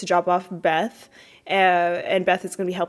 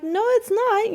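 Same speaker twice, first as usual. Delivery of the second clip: much higher indignant no; high mock protest; 14 semitones higher than usual. Viewer answering the boy, high indignant protest. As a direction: squeak out the objection. Speaker objecting, voiced.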